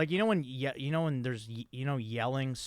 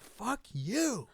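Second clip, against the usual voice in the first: high, mock-indignant retort; mimicked outburst without full volume; imitated angry insult; 7 semitones higher than usual. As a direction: hurl it back in a pitched-up voice. Imitates ejected man swearing back.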